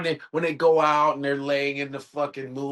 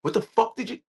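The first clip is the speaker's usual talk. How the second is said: angry; 11 semitones higher than usual. Retold or imitated. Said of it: "He goes".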